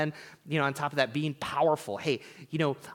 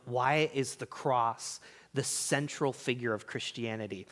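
ordinary talk

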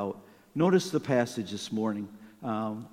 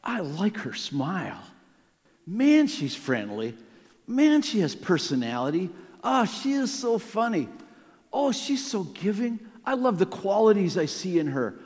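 starts easy, then climbs higher and louder into giddy delight; a smitten man getting more and more carried away; growing excitement; 6 semitones higher, 5 dB louder than usual. Pretend. Man smitten at first sight of his future wife, building giddy excitement. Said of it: "I'm guessing you were thinking".

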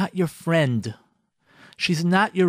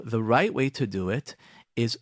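ordinary delivery

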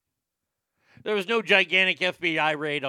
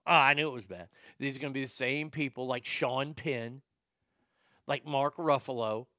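ordinary talk

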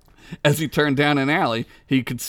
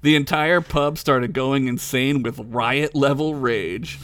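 ordinary talk

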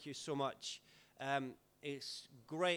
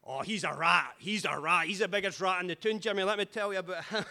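put-on louder, far-off drunk ranting with a laugh; drunk Scotsman; 4 semitones higher, 9 dB louder than usual. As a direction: slag everyone off like a drunk. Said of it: "he's like".

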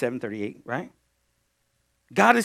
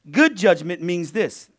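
ordinary talk, nothing marked

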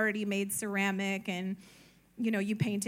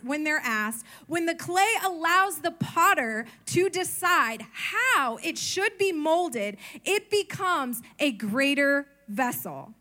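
preaching; 5 semitones higher, 4 dB louder than usual. Acted. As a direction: build it like a pulpit climax. Preaching climax.

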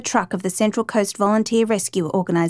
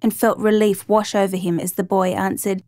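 usual speaking voice

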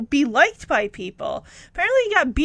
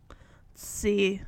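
whispered, softer musing; under breath; 9 semitones lower, 9 dB quieter than usual. Real, not acted. Reviewer weighing the episode rating, whispered aside. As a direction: mutter it to yourself while thinking.

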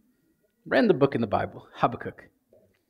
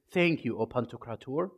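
formal prayer; 5 semitones lower, 8 dB quieter than usual. Retold or imitated. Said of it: "Is it".

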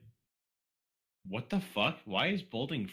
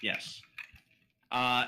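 ordinary talk, nothing marked

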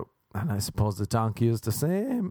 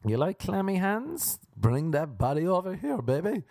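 mock flirty voice; 9 semitones higher than usual. Mock flirting in donkey bit.

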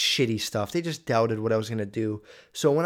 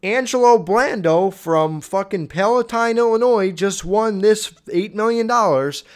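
mock news anchor; 7 semitones higher, 6 dB louder than usual. Vlogger mocking how the news would announce the winner, hammed-up anchor voice. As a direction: ham up a TV news anchor breaking the story. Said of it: "they're not gonna say, like".

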